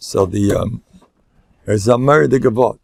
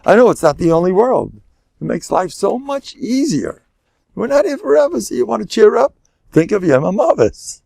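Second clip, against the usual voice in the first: high, lilting reassurance; cheery retold answer; 8 semitones higher than usual. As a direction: voice a chipper sage, pitched well up. Acting out rabbi's cheerful answer.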